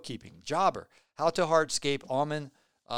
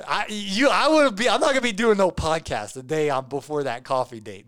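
high, loud, excited; 4 semitones higher, 8 dB louder than usual. Real, not acted. Nervous about the coffee date.

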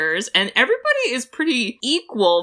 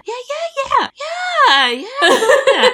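mocking yell; 5 dB louder than usual. Mocking a weak argument.

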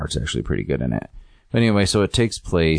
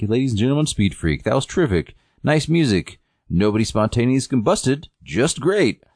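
raised-pitch imitation of a variety host; show emcee; 6 semitones higher than usual. Host thanks Speed Freak's act, higher emcee patter. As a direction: applaud the act as a peppy emcee.

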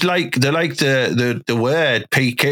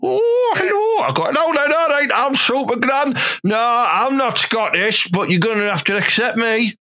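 high-pitched grandmother announcing herself; Super Gran; 9 semitones higher than usual. Acted. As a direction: put on a high-pitched granny, loudest up front. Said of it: "I'll go and play Super Gran".

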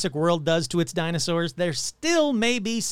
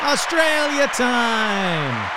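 put-on slow Australian accent, pitched higher and louder; higher, slower put-on Australian accent; bad Australian accent, higher; 5 semitones higher, 5 dB louder, much slower than usual. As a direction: stretch Australia out in a hammy Aussie drawl, higher. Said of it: "my bad Australian accent".

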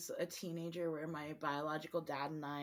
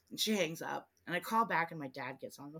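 ordinary delivery

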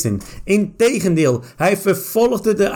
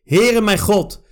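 usual speaking voice

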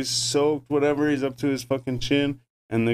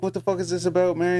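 mock indignant outburst; 7 semitones higher than usual. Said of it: "He's like".

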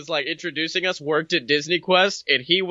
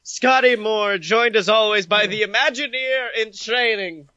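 bright, fast show-host welcome; announcer; 4 semitones higher than usual. Acted. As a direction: hype up the podcast intro.

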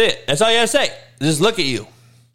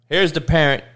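ordinary talk, nothing marked

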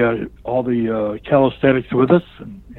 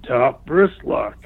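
"Top wrist lock" dragged out slowly and pointedly; slow repeat; much slower than usual. Retold or imitated. The trainer repeating the hint to the rookie, slowly. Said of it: "So he says it to me again".

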